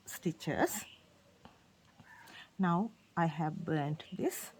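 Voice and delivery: usual speaking voice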